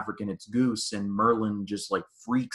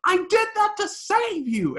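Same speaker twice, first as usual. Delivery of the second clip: very high, loud hammed-up outburst; mock angry yell; 5 dB louder than usual. Maverick shoving Rooster after the crash, mock furious yell. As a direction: imitate an exasperated shout at a wingman.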